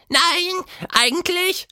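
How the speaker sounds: sehr hohe Monsterstimme, unsicher; zögerlich verneinen; Quatschmonster, zögernd. A narrator's reading. (Quatschmonster zögert am Wasserfall)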